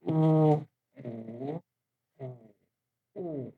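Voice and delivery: u level, rising, dipping, then falling; u in four tones. Shown as a demonstration. Teacher reading the row aloud, u through four tones. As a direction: run u through all four tones in turn. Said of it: "pay the attention, uh, to the tones".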